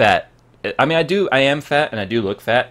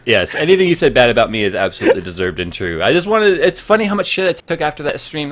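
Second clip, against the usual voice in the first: ordinary delivery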